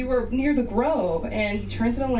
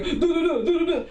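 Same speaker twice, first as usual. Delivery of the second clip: mock taunting; 7 semitones higher than usual. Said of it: "going back and forth".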